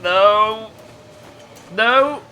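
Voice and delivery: panicked high shout of no; loud shout. Real, not acted. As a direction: yell no as the wolf strikes. Wolf attacks in game.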